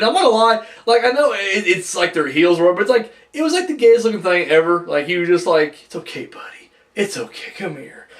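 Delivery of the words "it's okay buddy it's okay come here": put-on hushed, breathy comforting like calming a kid; soft mock-tender consoling, nearly whispered; soothing a child. Earthquake consoling Typhoon like a child, in a hushed tender voice. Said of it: "It was like he's a child".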